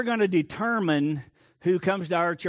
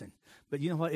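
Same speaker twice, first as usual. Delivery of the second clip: ordinary talk, nothing marked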